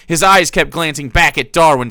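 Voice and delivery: normal talking voice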